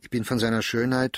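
ordinary delivery, nothing marked

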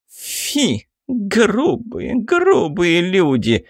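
high, slow, hammed-up camp huff; sassy drag queen. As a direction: overplay an offended diva. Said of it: "играет и переигрывает безбожно".

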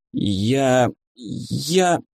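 halting, lost for words; choked-up hesitation from indignation; indignant stammer. A narrator's reading. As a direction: falter mid-outburst, searching for the word.

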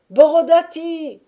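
very high, loud counter-cry; shouted answer. A narrator's reading. Rival camp answering Kirdiaga's backers, shouting. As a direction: hurl the rival name back. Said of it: "répondait-on".

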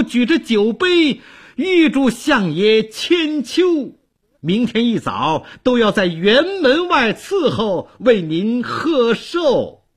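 high-pitched servant bursting with good news; eager herald, drawing out the final wish; excited, eager. A narrator's reading. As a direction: announce it gleefully, voice lifted. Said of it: "兴冲冲地对寇准说".

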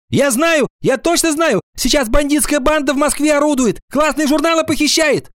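very high, insistent schoolboy voice; excited cry. A narrator's reading. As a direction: blurt out wild news to the class. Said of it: "закричал Витя Синицын". (возбуждённо выкрикивать)

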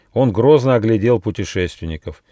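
usual speaking voice

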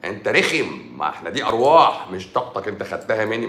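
ordinary delivery

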